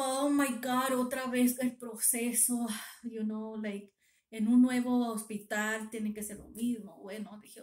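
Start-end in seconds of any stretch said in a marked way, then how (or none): 0.0-2.7 retold groan of "not this again"; dismayed. Patient dreading another needle, dismayed.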